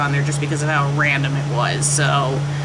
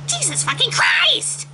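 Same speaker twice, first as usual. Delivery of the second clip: very high exasperated exclamation; high mock outburst. Reviewer outraged at the story, very high. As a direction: squeal it in outrage.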